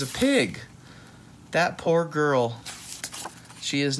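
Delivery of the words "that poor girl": plain reading voice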